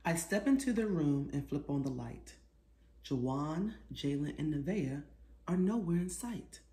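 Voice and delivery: lively picture-book read-aloud; reading to children. A narrator's reading. Author reading her book aloud to children, lively. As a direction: read it like storytime for little kids.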